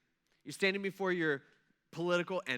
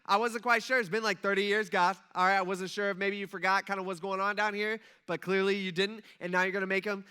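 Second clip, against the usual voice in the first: loud, excited mock gloating; gloating to God; 6 dB louder than usual. Imagined Daniel looking up at God, loud excited gloating. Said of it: "you're like".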